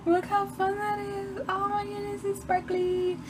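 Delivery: high, slow, hushed delight; softly thrilled. Real, not acted. Vlogger softly marvels at the sparkles to viewers. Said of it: "I'm so easily amused".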